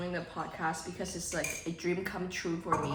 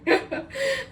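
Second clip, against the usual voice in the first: high squealing laugh; 6 dB louder than usual. Real, not acted. Squeals at own joke.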